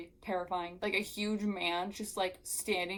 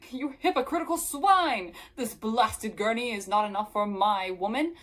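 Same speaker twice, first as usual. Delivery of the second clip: loud hammed-up melodramatic villain-style declaiming; mocking over-the-top macho bluster; mock pompous hero; 2 semitones higher, 7 dB louder than usual. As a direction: declaim it like an outraged stage blowhard, pitch swooping up. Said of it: "Why does he talk like that?".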